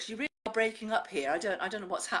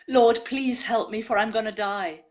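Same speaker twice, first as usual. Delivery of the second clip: louder, earnest plea within a poem; pleading cry; 2 semitones higher, 7 dB louder, slower than usual. A narrator's reading. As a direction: cry out to the Lord for help, recited. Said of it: "I heard the pleading cry".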